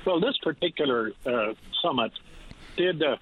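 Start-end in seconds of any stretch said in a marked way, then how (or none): none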